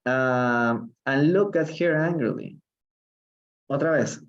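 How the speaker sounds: ordinary talk, nothing marked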